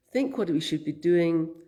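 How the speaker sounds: ordinary delivery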